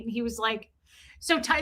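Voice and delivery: ordinary talk, nothing marked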